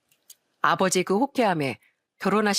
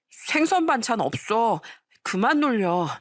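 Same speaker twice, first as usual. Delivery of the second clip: slow, higher-pitched meek schoolboy voice; timid bullied boy; 5 semitones higher, slower than usual. A narrator's reading. Bullied boy answering his teasing classmates, timid protest. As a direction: protest weakly, dragging the words out. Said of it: "저는 참 소심한 학창 시절을".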